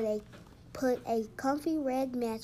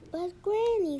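higher, louder pleading objection; child's protest; 7 semitones higher, 5 dB louder than usual. A narrator's reading. Patrick objects to his good night, protesting. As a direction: plead with Granny, voice lifted.

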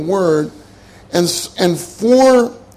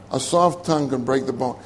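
softer echo of "A soft tongue can break the bone"; quiet emphasis; softer repeat; 5 semitones lower, 6 dB quieter than usual. Shown as a demonstration. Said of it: "Proverbs twenty-five fifteen says".

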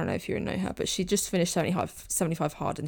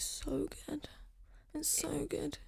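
breathy whispered awe; hushed gushing; 9 semitones higher, 13 dB quieter, much slower than usual. Real, not acted. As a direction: whisper it, savouring how good it is. Gushing over the world building.

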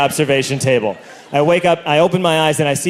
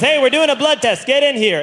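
calling out; 8 semitones higher than usual. Retold or imitated. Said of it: "he yells out into the hall".

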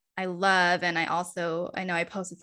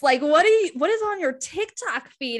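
higher, louder mock disbelief; incredulous niece; 8 semitones higher, 5 dB louder than usual. Niece grabs her phone, loud and baffled. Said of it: "she's like".

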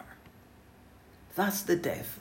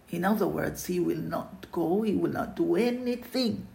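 ordinary delivery

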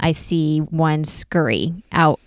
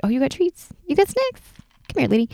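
much higher greedy critter; eager hamster voice; 9 semitones higher than usual. Retold or imitated. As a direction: voice the snack-hungry hamster. Hamster lured by treat.

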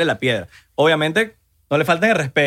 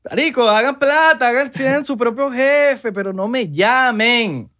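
higher, slow fed-up plea; exasperated plea; 8 semitones higher, slower than usual. Acted. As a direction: beg them to stop calling. Ranting at sales pitches.

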